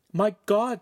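high, shocked exclamation; gasped. A narrator's reading. Friend learning of the abduction, high horrified gasp. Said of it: "Chimera gasped".

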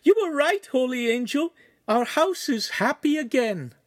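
very high, elated woman's voice; joyful squeal, loud at first. A narrator's reading. Signora to the holy angel about her happy house, squealing. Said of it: "she squealed".

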